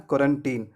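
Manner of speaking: put-on क्वारंटीन ending in a long "teen"; correct pronunciation. Shown as a demonstration. Teacher models the word for learners, क्वारंटीन with "teen" ending. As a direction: say क्वारंटीन with last syllable "teen". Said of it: "इसे पढ़ना है टीन".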